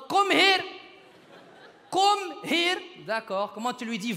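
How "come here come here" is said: put-on high-pitched, accented summons; imitated father's English. Father showing how to say come here, high accented call. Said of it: "Il y a mon père en anglais".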